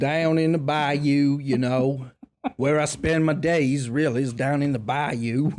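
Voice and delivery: slow Southern bayou drawl; hammed-up Creole Southern drawl; Louisiana bayou accent. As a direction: stretch it out like a Louisiana local. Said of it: "real Southern Creole Louisianan".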